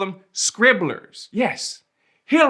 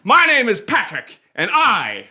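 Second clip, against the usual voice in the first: proud shout; 6 semitones higher, 4 dB louder than usual. Acted. Proud closing declaration.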